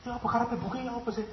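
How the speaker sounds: high, incredulous what's wrong with this book; baffled question about a book; puzzled reader. Acted. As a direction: ask it confused and exasperated.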